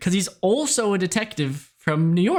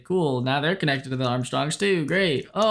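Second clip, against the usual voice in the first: usual speaking voice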